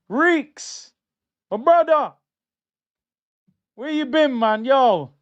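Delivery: excited greeting. Real, not acted.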